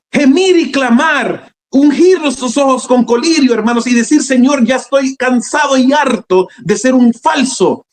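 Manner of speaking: high-pitched fervent sermon delivery; urgent preaching. Real, not acted. Preacher urging brethren that Christ is coming, urgent preaching.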